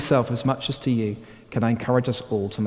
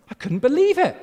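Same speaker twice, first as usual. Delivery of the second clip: ordinary talk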